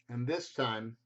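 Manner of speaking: usual speaking voice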